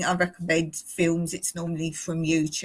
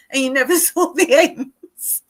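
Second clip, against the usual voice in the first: laughing; 5 semitones higher, 6 dB louder than usual. Real, not acted. Laughing at childhood memory.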